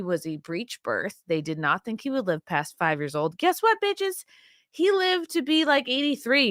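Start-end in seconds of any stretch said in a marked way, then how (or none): 3.4-6.5 much higher, a sassy taunt; gleeful taunt. Real, not acted. Taunting the doctors who doubted Roy, with gleeful sass. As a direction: toss it off with triumphant sass. Gloating about great uncle Roy.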